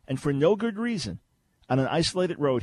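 ordinary talk

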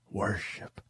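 breathy whispered word. Real, not acted. Preacher whispering to listeners to worship. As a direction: say it softly like a prayer.

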